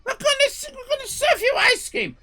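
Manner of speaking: very high, loud shouting owner; furious owner. Retold or imitated. Owner yelling at customers to order lasagna. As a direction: scream it like a furious restaurateur. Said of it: "owner insists".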